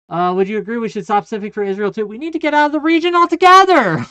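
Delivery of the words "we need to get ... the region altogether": shouted excitement. Real, not acted.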